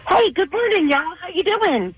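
high, cheery greeting; bubbly. Real, not acted. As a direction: greet them all sunny and upbeat. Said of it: "You sound so bubbly".

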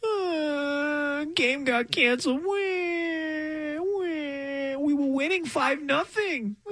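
high whimpering wah; fake crying baby. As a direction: blubber like a cranky toddler. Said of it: "that's how they sound".

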